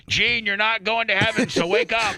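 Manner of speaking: high-pitched imitation of a yelling medic; mock yell. Medic rousing knocked-out Gene from near death, mock yell. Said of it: "the medic with the fire extinguisher yelled".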